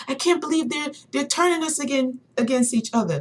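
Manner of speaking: high-pitched disbelieving retelling; imitating passionate man. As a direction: act out his worked-up dismay. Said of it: "he was so passionate about what he was talking about".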